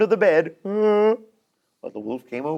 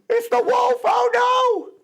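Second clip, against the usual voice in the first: high gasp, then dread; gasping fright. Acted. Pigs realise the wolf is on the roof, frightened. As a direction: gasp in sudden terror.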